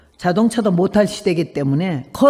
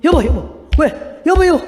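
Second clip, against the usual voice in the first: louder and higher calling of her name; alarmed calling; 7 semitones higher, 4 dB louder than usual. Retold or imitated. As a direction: shake her awake with your voice. Husband wakes her in alarm.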